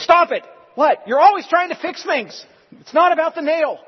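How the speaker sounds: high, loud, fed-up shout; exasperated shout. Retold or imitated. Wife shouting at husband who keeps fixing, exasperated. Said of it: "She goes".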